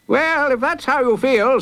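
high, pompous satisfaction; gleeful judge. A narrator's reading. Judge pleased with a guilty verdict, gleeful. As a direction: relish it in a high voice. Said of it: "rubbing his hands together gleefully".

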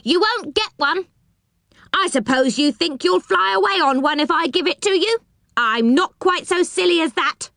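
high-pitched, indignant character voice; irritated, scornful storybook figure; cross doorkeeper. A narrator's reading. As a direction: scold through the door in a high voice.

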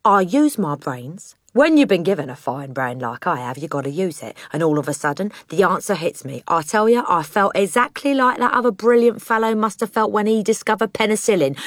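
self-satisfied cockney dad, swelling with pride; boastful crooked father. Acted. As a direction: brag about your own cleverness.

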